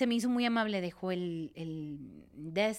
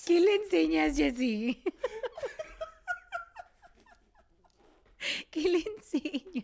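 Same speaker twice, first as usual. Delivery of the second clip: high and loud, laughing; laughing tease; 10 semitones higher, 4 dB louder than usual. Real, not acted. Wife teasing husband about what he teaches, high and laughing. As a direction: tease him through laughter.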